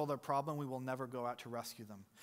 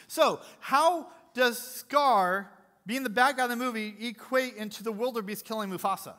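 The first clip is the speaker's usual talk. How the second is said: preaching build; 6 semitones higher, 8 dB louder than usual. Real, not acted. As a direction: drive the point home to the church. Preaching at full force.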